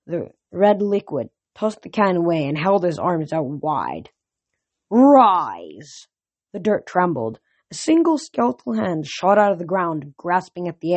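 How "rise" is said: loud, drawn-out, falling call; summoning command. A narrator's reading. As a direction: raise the dead with one long cry. General summons skeletons from the ground.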